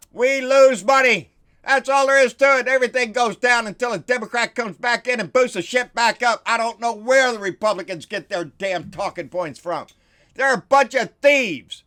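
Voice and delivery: worked-up ranting; carried away. Real, not acted. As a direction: lose yourself in the anger. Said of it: "sorry, I get carried away".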